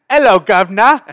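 loud, high British caricature; hammed-up British greeting, imitating a friend; mock British accent. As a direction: holler it like a stage cockney Brit. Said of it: "his best British accent and saying".

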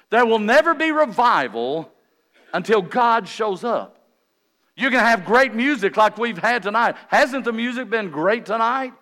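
loud, high, driving pulpit delivery; preaching. Real, not acted. Preacher telling congregation what revival needs, forceful preaching. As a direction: preach it out, hammering each repeated phrase.

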